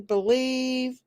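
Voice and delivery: ordinary talk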